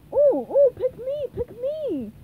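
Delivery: high, loud, excited plea; bursting with eagerness; eager begging. A narrator's reading. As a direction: beg to be chosen like an eager child. Patrick begs to join team.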